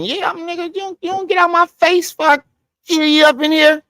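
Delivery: high, strained comic yell; mock threat. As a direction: snap back high at him to get out of your face. Imagined reply to [name]'s offer.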